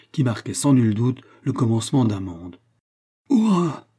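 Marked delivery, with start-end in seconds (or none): 3.3-3.9 high, loud cry of joy; exultant hurrah; triumphant shout. A narrator's reading. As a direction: fling it out to the open sea. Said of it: "cria Cornelius".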